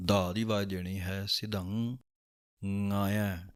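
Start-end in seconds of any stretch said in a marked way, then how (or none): 1.3-3.5 ਸਿਧੰਗਾਇਆ drawn out, sidh then ngaa-iaa; very slow sidhangaaiaa. Shown as a demonstration. Teacher joining the parts of ਸਿਧੰਗਾਇਆ. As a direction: stretch ਸਿਧੰਗਾਇਆ syllable by syllable. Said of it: "ਦੋਵਾਂ ਨੂੰ ਇਕੱਠਿਆਂ ਬੋਲ ਦੇਣਾ ਹੈ".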